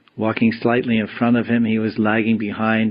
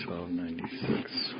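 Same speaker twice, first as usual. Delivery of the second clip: whisper; 4 semitones lower, 15 dB quieter than usual. Real, not acted. Whispered verse number.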